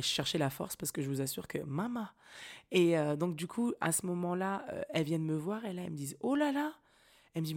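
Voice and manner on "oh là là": high, louder oh là là; mock surprised midwife. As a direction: exclaim like startled midwife.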